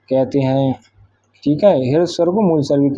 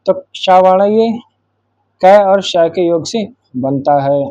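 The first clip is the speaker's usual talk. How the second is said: slow explaining; slower than usual. Shown as a demonstration. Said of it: "तो छा वर्ण किसके योग से बनता है?".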